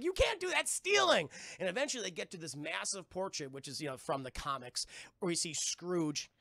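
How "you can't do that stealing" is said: high-pitched mock outrage; scandalized scolding. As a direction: scold in a high, shocked squeal. Scolding a kid for stealing.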